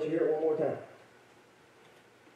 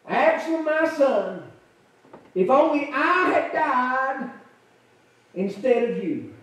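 higher, slow grieving cry; mournful lament; 4 semitones higher, slower than usual. Acted. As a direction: voice a father mourning his son. David's lament quoted.

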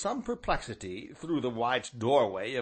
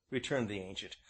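ordinary delivery, nothing marked

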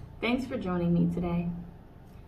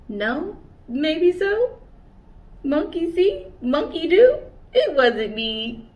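much higher and louder, teasing; cheeky monkey; 7 semitones higher, 8 dB louder, slower than usual. Acted. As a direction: tease them like a cheeky monkey.

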